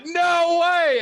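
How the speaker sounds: stunned disbelief. Retold or imitated. Hearing the sound guy is a member, a high stunned disbelief. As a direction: gasp it out in pure disbelief. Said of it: "And I thought".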